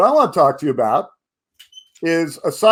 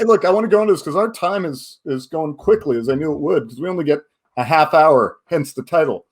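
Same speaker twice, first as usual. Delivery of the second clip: normal talking voice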